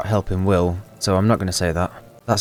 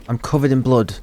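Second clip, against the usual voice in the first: ordinary talk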